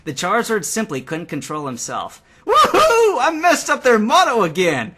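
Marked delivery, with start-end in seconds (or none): 2.5-4.9 very high, loud gleeful whoop; shrieking triumphant cheer; happy cheer. A narrator's reading. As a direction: crow with delight at the prank. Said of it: "he cheered happily".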